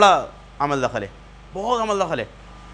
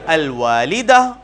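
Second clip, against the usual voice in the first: slow model word; 3 semitones lower, 4 dB louder, much slower than usual. Shown as a demonstration. Teacher models word slowly.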